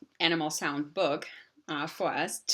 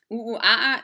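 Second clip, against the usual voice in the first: louder ooh ooh aah aah; monkey hoots; 5 semitones higher, 7 dB louder, faster than usual. Shown as a demonstration. Teacher repeating the monkey sound. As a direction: hoot it like a monkey. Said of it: "monkey call repeated".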